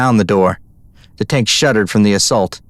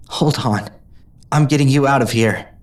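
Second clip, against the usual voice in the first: reassuring; 3 semitones higher than usual. A narrator's reading. Rescuer reassuring trapped friend.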